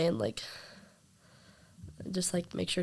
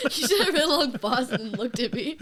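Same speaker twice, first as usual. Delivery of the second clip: laughing; 9 semitones higher, 8 dB louder than usual. Real, not acted. Teasing dad, laughing.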